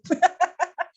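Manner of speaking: bubbling amused laugh. Real, not acted. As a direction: laugh out at your own list. Laughs at own list.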